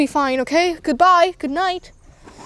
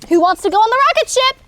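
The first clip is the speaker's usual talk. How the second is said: eager call; 6 semitones higher, 4 dB louder, faster than usual. Pretend. Calling out to the toys.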